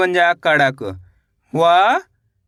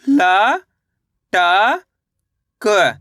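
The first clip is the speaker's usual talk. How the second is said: each letter drawn out, with a long pause after it; stretched, separated syllables; much slower than usual. Shown as a demonstration. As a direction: sound out the letters one at a time for learners. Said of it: "मेरे साथ साथ इनको repeat".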